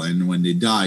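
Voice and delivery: normal talking voice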